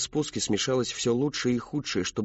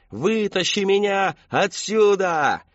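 slow, higher, louder distant howl; echoing scream; 9 semitones higher, 5 dB louder, slower than usual. Acted. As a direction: howl it so it echoes through the woods. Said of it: "Долбунец орал так, что его крики эхом".

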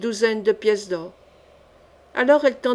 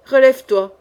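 louder, firm order; commanding; 2 semitones higher, 8 dB louder than usual. A narrator's reading. King to the prostrate jailer, commanding. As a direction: give a curt royal command.

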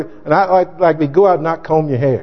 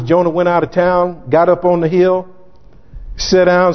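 normal talking voice

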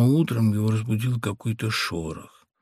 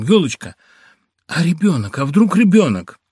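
high, loud sudden fear; frightened; 7 semitones higher, 9 dB louder than usual. A narrator's reading. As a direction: jolt up in alarm. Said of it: "произнёс с испугом". (испуганный возглас мужа жене)

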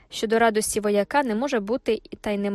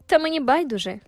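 higher, fresh-voiced reply; свіжий голос Батшеби; 5 semitones higher, 4 dB louder than usual. A narrator's reading. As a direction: answer freshly, lifting the voice. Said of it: "пролунав свіжий голос Батшеби". (свіжий, піднесений жіночий голос)

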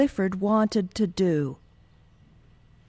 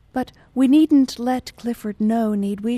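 raised, insistent plea; plead, leaning louder into the opening; 3 semitones higher, 7 dB louder than usual. A narrator's reading. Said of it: "she pleaded".

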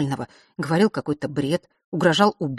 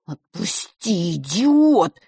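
croaked; 4 dB louder, much slower than usual. A narrator's reading. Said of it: "хрипел Норт".

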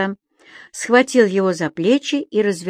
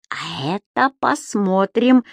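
медленно и с угрозой; зловещее обещание; зловеще; slower than usual. A narrator's reading. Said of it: "зловеще проговорил господин Дауме". (Дауме зловеще обещает Субастику, медленно)

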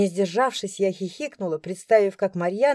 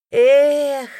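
loud disappointed sigh; boy's sigh; 7 semitones higher, 9 dB louder than usual. A narrator's reading. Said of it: "вздохнул мальчишка".

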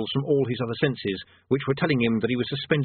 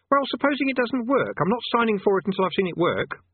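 put-on high-pitched, protesting boy voice; stubborn boy; 11 semitones higher than usual. Boy refusing to sign for the world, high stubborn voice. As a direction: object like a suspicious kid, pitched right up.

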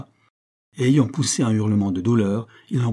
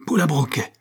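frightened cry; 5 semitones higher than usual. A narrator's reading. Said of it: "s'écria Otto Friedenstrass".

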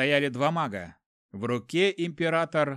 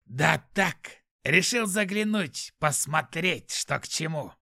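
cheerful demon; 2 semitones higher, slower than usual. A narrator's reading. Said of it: "весело ответил демон".

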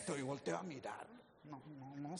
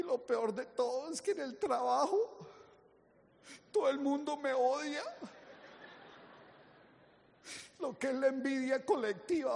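higher, whiny despair; lamenting woman; 4 semitones higher than usual. Retold or imitated. Distraught wife complaining to the doctor, whiny lament. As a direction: complain in high, weepy despair. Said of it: "llegó llorando".

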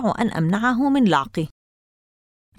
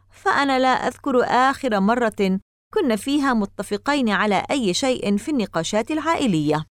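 plain reading voice

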